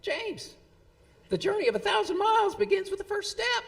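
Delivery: high, loud, fast proclamation in a Tennessee twang. Acted. Imitating a friend and colleague's words of wisdom, loud Tennessee twang. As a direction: holler it out in a rousing Tennessee twang. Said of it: "would proclaim in his Tennessee twang".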